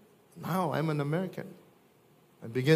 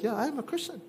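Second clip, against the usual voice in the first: proud; 9 semitones higher, 4 dB quieter, faster than usual. Pretend. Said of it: "You can be proud of it".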